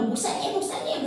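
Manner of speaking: squeezed, pleading imitation; imitated calling out. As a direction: call for the pastor in a thin put-on voice. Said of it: "그러면서 또 막 부르더래".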